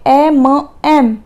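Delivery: ए म एम split into slow separate sounds; sounding out slowly. Shown as a demonstration. Teacher to students, sounding out letter M: ए म एम slowly. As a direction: break it down: ए, then म, then एम. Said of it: "ए की sound आ रही है".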